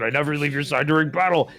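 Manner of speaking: ordinary talk, nothing marked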